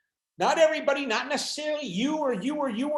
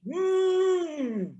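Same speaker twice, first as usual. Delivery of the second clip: mock wounded whimper; 8 semitones higher than usual. Acted offended sound.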